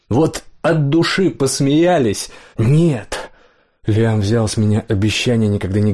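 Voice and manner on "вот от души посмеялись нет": higher, slow, hurt outburst; voice breaking. A narrator's reading. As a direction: drag it out, on the verge of breaking. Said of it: "его голос сорвался".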